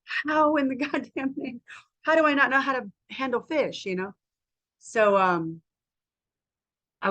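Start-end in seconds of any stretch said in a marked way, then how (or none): 0.0-3.8 tearful self-reproach. Retold or imitated. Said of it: "I'm tearing up already because I'm like".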